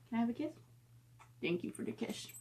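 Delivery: soft, near-whispered pet talk; whispered to dog. Real, not acted. Owner whispering to her corgi for a kiss. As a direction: murmur sweetly to the puppy.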